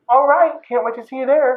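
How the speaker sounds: high, bright put-on friendliness; fake cheer. [name] luring Julie on the phone, put-on cheer pitched high. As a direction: sound chirpy to hide the trap.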